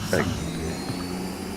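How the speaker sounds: slow, low, soft muttering; muttered curse. Retold or imitated. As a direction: drag it out under your breath. Realizing he updated the pack.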